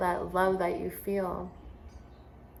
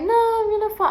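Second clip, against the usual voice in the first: put-on scared crying child; 14 semitones higher, 7 dB louder, faster than usual. Boy on the rock wall pleading with his teacher, high frightened child voice. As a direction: play the frightened boy sure he'll fall. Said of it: "he starts crying".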